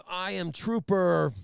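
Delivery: loud, slow, self-important announcement; swaggering trooper. Retold or imitated. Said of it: "attitude of this big swinging cock".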